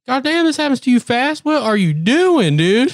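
high-pitched incredulous outburst; hammed-up dismay, stretching the question; acted exasperation. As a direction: scold a friend in squeaky disbelief. Retold exasperated reaction to tense muscles.

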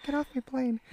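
high-pitched mock action-hero order; Harrison Ford. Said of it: "I'm Harrison Ford".

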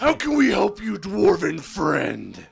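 fawning NPC greeting. Acted. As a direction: stretch out the dwarven friend.

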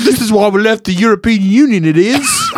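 proud Brit, hammed up in a British accent; mock British. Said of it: "They're like".

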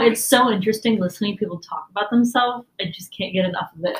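fast dry sarcasm; sarcastic. Real, not acted. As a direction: rattle it off deadpan. Sarcastic self-mocking remark.

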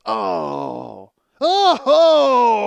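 groan, then shriek in disgust; loud grossed-out crowd cry. Retold or imitated. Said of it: "everybody just goes".

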